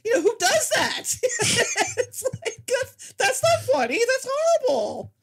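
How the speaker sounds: high-pitched laughing disbelief; giggling while protesting. Real, not acted. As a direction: laugh through the words, appalled. Laughing through outrage at Exorcist.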